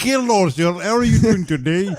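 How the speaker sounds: put-on high Russian accent greeting. Friend greets a man in a jokey Russian accent. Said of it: "a very good Russian accent".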